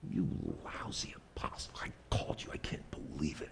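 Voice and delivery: a disgusted whisper of rebuke; scornful whisper. Retold or imitated. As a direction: hiss it, cold and contemptuous. Imagined Jesus scolding Peter.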